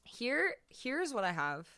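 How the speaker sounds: ordinary talk, nothing marked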